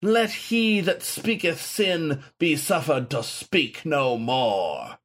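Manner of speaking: high, slow, lofty decree; declaiming. A narrator's reading. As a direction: pronounce it as holy judgment. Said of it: "Saint Galahad declaimed".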